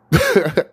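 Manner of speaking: sharp high laugh bursting out; a loud bitter laugh; burst of laughter. Real, not acted. Remembering covid hitting after the concert, loud laugh.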